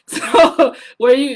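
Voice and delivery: laughing. Real, not acted. Laughing at own joke.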